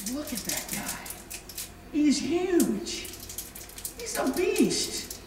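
hushed, awed murmur, slowing down at the end; awed soldiers. Acted. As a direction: mutter it to the soldier beside you, scared. Army whispering about Goliath.

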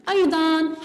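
ordinary talk

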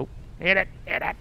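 high, eager urging; will the throw to land, pitch up; excited urging. Real, not acted. Player urging the game to hit the mark, excited.

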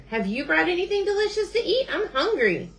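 high, eager bird voice; hungry Bird. A narrator's reading. Bird asks Beaver for food, high hungry plea. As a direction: plead for food in a high character voice.

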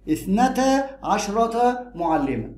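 slow model phrase. Shown as a demonstration. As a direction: say اثنتا عشرة معلمة slowly and clearly. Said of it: "teacher models the full phrase".

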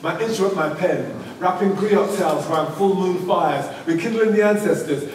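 rhythmic, measured poem delivery; poem recital. Acted. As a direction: recite it like spoken word on stage.

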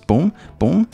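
ordinary talk

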